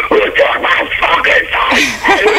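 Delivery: mock furious roar, high and drawn out; drunken rage. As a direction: imitate an enraged rocker yelling. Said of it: "they came back and goes".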